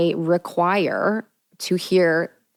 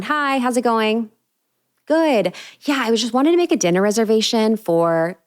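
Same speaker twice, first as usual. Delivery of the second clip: higher, polite and palatable; nice phone voice; 4 semitones higher than usual. Retold or imitated. Woman booking dinner by phone, voice pitched up high. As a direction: pitch up to sound pleasant. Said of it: "go up to the top to sound nice".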